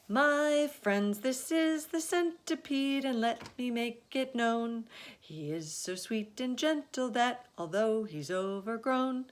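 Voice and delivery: high, lilting verse; rhyming recital. Acted. James introduces the centipede in verse, high and lilting. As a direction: present each friend proudly in rhyme.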